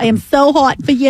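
mock-seductive Long Island whine; Long Island accent. Co-host plays a woman from Long Island, mock-sultry accent. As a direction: stretch the vowels like Long Island. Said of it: "I like the Long Island".

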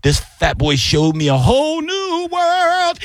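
slow build soaring high at the end; hammed-up revelation, pitch leaping up; imitated joyful cook. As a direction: stretch it into a near-sung, mock-rapturous finish. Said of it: "you could see the joy in his face".

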